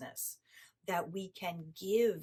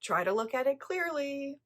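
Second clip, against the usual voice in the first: mock blunt scolding; 6 semitones higher, 5 dB louder than usual. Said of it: "He's very politely trying to say".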